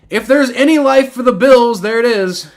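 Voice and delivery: loud, high outburst after a touchdown; excited exclamation. Real, not acted. Streamer sees life for the Bills, loud exclamation.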